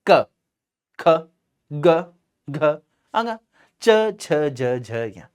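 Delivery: short, crisp schwa on each letter from क to झ; correct letter sounds. Shown as a demonstration. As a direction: say क, ख, ग with a short neutral 'uh' vowel. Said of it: "क्या है ये?".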